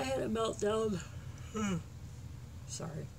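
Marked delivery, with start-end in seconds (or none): none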